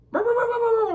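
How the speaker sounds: high, loud mock barking; imitating the dog barking at its reflection; dog bark imitation. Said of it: "He's like".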